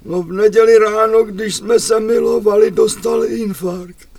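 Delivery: a hint of a frail old lady; lightly voiced old grandmother.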